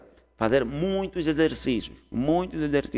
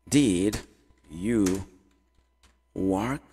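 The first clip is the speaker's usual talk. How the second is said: slow, separated words with long pauses; 4 semitones lower, much slower than usual. Shown as a demonstration. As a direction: spell out the question one word at a time. Teacher builds question word by word. (palavra por palavra, bem devagar)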